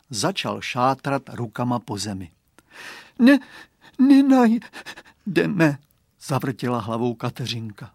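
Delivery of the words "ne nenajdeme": put-on sad little girl. A narrator's reading. Dziewczynka smutnie odmawia.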